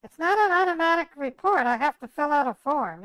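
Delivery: loud, insistent objection. Real, not acted. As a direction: push back hard and loud. Arguing with bank manager.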